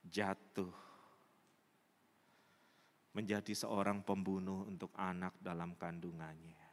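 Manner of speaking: low, halting, grave delivery; solemn preaching. Real, not acted. Preacher tells congregation of her fall, solemn preaching. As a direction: let each phrase fall heavily between long pauses.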